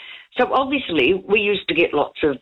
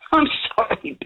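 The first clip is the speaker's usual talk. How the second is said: laughing apology; 10 semitones higher than usual. Real, not acted. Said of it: "but I'm still laughing".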